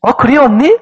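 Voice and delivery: lightly voiced younger sister; younger sister replying. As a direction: answer big sister eagerly. Acts the sister's cheerful reply.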